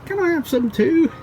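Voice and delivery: high pleading pet voice; begging dog voice. Retold or imitated. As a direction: beg for food like a dog.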